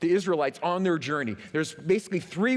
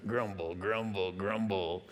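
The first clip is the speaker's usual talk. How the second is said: low, soft rumbling grumble; much lower, softer muttered grumble; grumbling sound; 9 semitones lower, 7 dB quieter, slower than usual. Shown as a demonstration. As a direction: growl the word low under your breath. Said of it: "It just sounds wicked".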